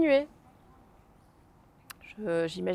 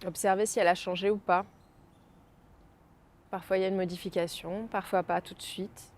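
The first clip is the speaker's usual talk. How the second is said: guided meditation. Acted. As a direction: gently bring them back. Guides out of the exercise. (voix douce de méditation guidée)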